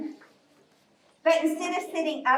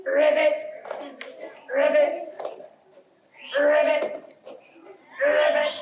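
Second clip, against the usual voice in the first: frog ribbit. Pretend. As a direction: pretend frog calls, popped out one at a time. Leading children jumping like frogs.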